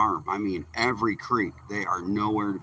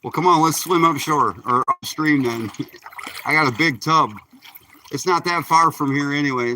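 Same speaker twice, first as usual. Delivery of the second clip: raised voice, higher and louder; calling out; 6 semitones higher, 7 dB louder than usual. Real, not acted. Talking to a fishing buddy in tow, calling out. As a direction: call it over the water to a buddy.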